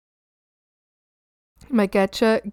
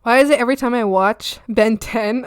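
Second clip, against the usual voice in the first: ordinary talk, nothing marked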